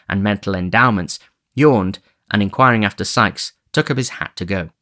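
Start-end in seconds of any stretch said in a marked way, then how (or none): none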